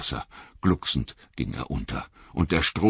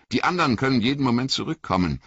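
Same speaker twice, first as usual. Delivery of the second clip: hurried; 9 semitones higher, much faster than usual. Acted. Frodo urges haste.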